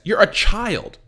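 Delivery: high, loud, exasperated rant; real incredulous outburst; outraged disbelief. Real, not acted. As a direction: go up and loud, appalled at the screen. Outraged rant about kidnapping scene.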